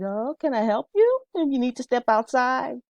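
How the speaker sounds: high, solicitous offer of help; teacher to angry student, high and kind; helpful offer. Retold or imitated.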